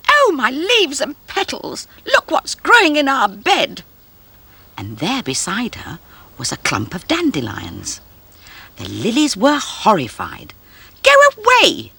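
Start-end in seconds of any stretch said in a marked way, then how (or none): 0.1-3.8 scream. A narrator's reading. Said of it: "suddenly screamed". 11.0-11.9 very high, loud shout; crying out. A narrator's reading. Lilies ordering the dandelions out, very high loud shout. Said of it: "they cried".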